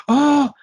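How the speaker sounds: high, loud gasped "oh"; mock horrified gasp; shocked gasp. Reacting to seeing the shoe.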